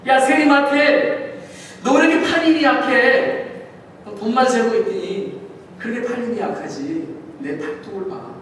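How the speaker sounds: a hint of brash Peter, loud then settling; lightly voiced fisherman taunting a tax collector; Peter scolding. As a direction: tease a weak rower, big and cocky. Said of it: "베드로는 이랬을지 모릅니다".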